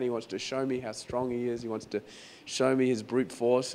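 usual speaking voice

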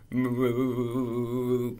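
long, juddering moo; jumpy, jiggly moo. Acted. Reader acts a cow on a skateboard, jiggly moo. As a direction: judder the moo as if over bumps. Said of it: "jiggly moo is jumpy".

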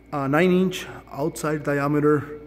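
ordinary talk, nothing marked

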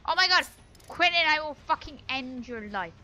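loud exasperated threat; explode, then push the threat out hard; angry outburst. Real, not acted. Gamer threatening Quentin in game, angry outburst.

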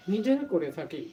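normal talking voice

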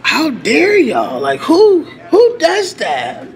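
slow, high disbelief; fed up with the police; indignant outrage. Real, not acted. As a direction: drag out the outrage.

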